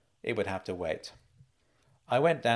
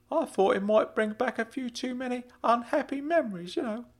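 high, careful uncle voice; Uncle Claude, concerned; 13 semitones higher than usual. Acted. Uncle worries prison documentary upsets nephew, high and concerned. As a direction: tread carefully in a high character voice.

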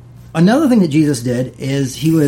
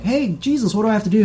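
eager believer; 7 semitones higher, faster than usual. Retold or imitated.

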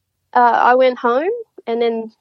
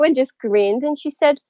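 ordinary talk, nothing marked

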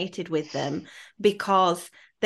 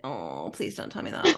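low, soft groaned plea; deep embarrassed groan; mock dismay; 10 semitones lower, 4 dB quieter than usual. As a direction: drop low and plead in mock despair. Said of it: "I'm like".

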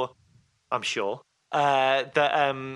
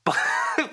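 high burst of laughter; amused laugh. Real, not acted. Amused at co-host's accent question.

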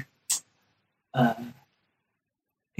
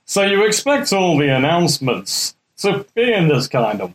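put-on children's railway story narrator; impression of a Liverpudlian storyteller. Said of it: "narrated by originally [name]".

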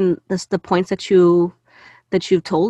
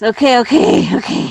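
steadying breath; 5 semitones higher, 6 dB louder than usual. Real, not acted. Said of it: "okay, deep breath, everybody".

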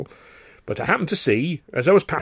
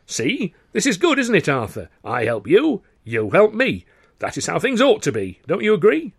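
louder, high cheerful singsong; jolly rhyme; 9 semitones higher, 5 dB louder than usual. A narrator's reading. Carno jollily rhymes to Arthur, pleased. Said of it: "speaking in rhyme".

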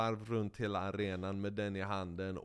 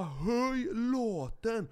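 drawn-out yelled order; 11 semitones higher, 3 dB louder, much slower than usual. Retold or imitated. Ordering officials to turn it up.

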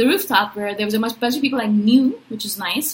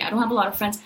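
normal talking voice

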